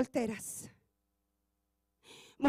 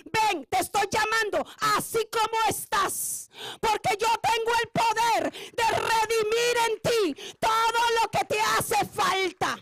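preaching God's call; 6 semitones higher, 4 dB louder than usual. Acted.

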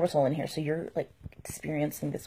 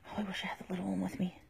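whispering; 8 dB quieter, much faster than usual. Real, not acted. Quiet aside while unboxing.

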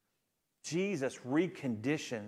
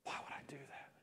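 whispered, broken, to himself; despairing whisper; 2 semitones higher, 17 dB quieter than usual. Acted. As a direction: whisper it like a man who can't forgive himself. Peter in despair after denying Jesus.

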